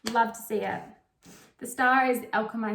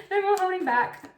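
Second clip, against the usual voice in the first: laughing, higher pitched urging; 8 semitones higher, 5 dB louder than usual. Retold or imitated. Spirit urging the listener on, laughing as she says it. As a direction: giggle through the push. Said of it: "Spirit's like".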